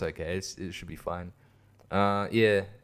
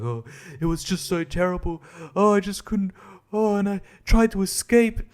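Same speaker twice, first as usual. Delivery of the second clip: high, overwrought distressed acting; mock-distraught, pitched well up; demoralized; 8 semitones higher than usual. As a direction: imitate a traumatized character with hammed-up anguish. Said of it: "demoralized person who's gone through P T S D".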